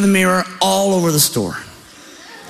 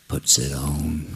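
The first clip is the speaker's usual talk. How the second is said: very low, soft gravity; mock-dramatic hush; 13 semitones lower, 9 dB quieter, slower than usual. Hunter donning leisure suit, low hushed drama. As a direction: drop to a deep, solemn hush.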